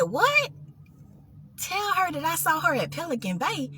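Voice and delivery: soft, puzzled questioning; hushed disbelief. Retold or imitated. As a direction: question it under your breath. Questions the voice in her head.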